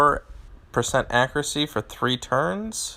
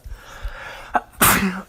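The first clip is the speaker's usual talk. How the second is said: sudden real sneeze; 7 semitones higher than usual. Real, not acted. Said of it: "Oh, I have to sneeze".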